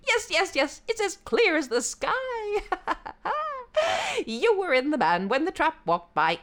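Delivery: high, loud jackal yelps; excited shout. Acted. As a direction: shout with giddy glee.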